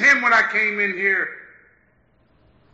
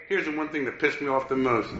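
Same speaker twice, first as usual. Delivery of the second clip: normal talking voice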